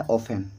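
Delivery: "ऑफेन" with no t after the f; silent T. Shown as a demonstration. Teacher giving the real pronunciation, silent T in "ऑफेन". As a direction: drop the T in "ऑफेन". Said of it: "इसमें टी जो है वो silent होता है".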